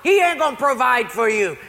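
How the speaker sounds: high, loud angry shout; acted outburst of an irate neighbor. Atheist neighbor telling the praying lady off, irate shout. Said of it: "the atheist was getting a little irate".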